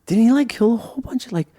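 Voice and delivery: high, loud fake-innocent questioning; trolling innocence. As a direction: bait them with mock innocence. Trolling the Witnesses.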